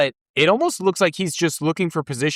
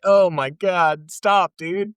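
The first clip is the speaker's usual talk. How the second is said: slow, drawn-out disbelieving plea; mock exasperation; 3 semitones higher, much slower than usual. Fan reacting to the same loss repeated, exasperated.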